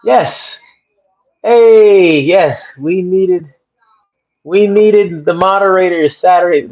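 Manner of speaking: high, loud yes of joy; thrilled to see her there; delighted recognition. Real, not acted. Greeting Stephanie Risby on the live stream, delighted.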